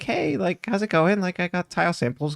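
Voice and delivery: imitating a sugary drop-in hello, high; perky Melissa. Melissa bursts in, chirpy greeting.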